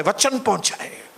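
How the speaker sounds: ordinary delivery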